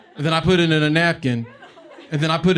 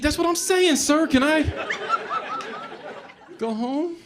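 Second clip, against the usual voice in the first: high pleading whine. Retold or imitated. Comic begging the swat cop to let him go home, high whine. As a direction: voice shoots way up, begging.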